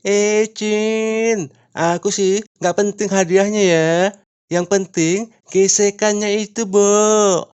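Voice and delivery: high, camp put-on voice, drawn out at the end; relaxed camp reply. Climber answers the angry crowd, relaxed and camp. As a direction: answer sweetly, unbothered. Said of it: "menjawab dengan santainya".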